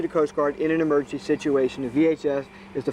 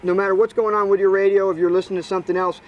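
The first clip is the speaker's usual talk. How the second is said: usual speaking voice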